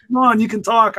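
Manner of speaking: ordinary talk, nothing marked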